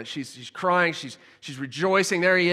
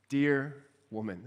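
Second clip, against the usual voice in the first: soft, slow, low-voiced dear woman; tender endearment; 5 semitones lower, 6 dB quieter than usual. Jesus tenderly comforting a weeping Mary, soft and slow. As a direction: say it gently like a term of endearment. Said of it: "this little term of endearment".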